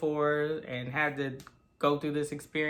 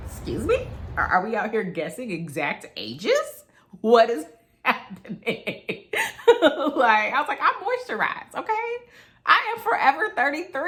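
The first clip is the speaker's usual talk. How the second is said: high, loud, mock-offended protest with laughs; indignant diva; 7 semitones higher, 6 dB louder than usual. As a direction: play the insulted vain lady. Offended at guessed age.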